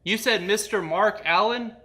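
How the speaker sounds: usual speaking voice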